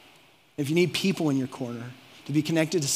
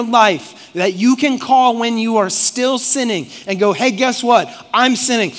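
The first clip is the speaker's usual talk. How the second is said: loud, higher-pitched sermon delivery; impassioned preaching; 6 semitones higher, 8 dB louder than usual. Real, not acted. Preacher urging the congregation to confess, impassioned and loud. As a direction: preach it hard and loud, driving each point home.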